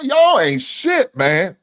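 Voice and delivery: high, loud, stretched-out rant at the chat; exasperated yell. Real, not acted. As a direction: let it burst out slow and high. Ranting at chat.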